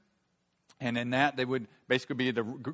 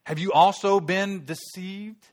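scornful Pharisees; 7 semitones higher, 8 dB louder, much slower than usual. Acted. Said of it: "And the Pharisees answered them".

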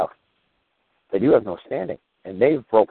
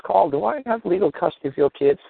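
high mock-mom voice, pitched way up; imitating mom; 9 semitones higher than usual. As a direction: play his mother asking, lightly imitated. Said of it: "She says".

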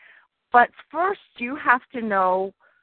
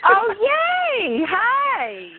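excited; 12 semitones higher, 3 dB louder, much slower than usual. Real, not acted. Greeting co-host joining call.

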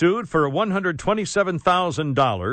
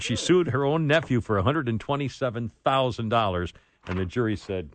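normal talking voice

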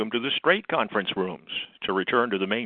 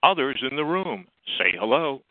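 phone menu voice; 8 semitones lower than usual. Acted. Automated conference line announcement.

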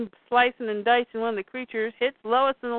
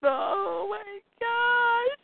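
mock agonized scream; 11 semitones higher, much slower than usual. Voicing a woman having her leg cauterized, mock agonized scream. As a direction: climb higher as if screaming in pain. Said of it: "scream as loud as you want".

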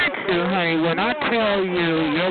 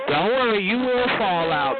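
usual speaking voice

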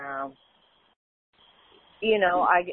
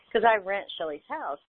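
usual speaking voice

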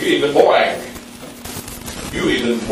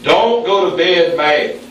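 ordinary talk

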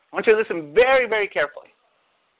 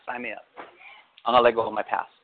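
ordinary talk

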